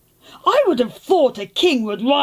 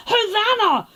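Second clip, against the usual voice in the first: mild mock shout; 9 semitones higher than usual. Excited crowd greeting Jesus coming into Jerusalem, high mock shout. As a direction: call it out high without really shouting. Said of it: "So they started waving palm branches and shouting".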